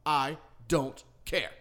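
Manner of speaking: the dog lady ending the argument, slow and dismissive. Retold or imitated. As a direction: drag it out and walk off.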